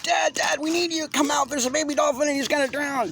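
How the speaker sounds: put-on high little boy voice, pleading in panic; panicked child. A narrator's reading. Boy runs to his father, high and panicked. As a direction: shout for Dad like a frightened kid. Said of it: "I run back almost in a panic".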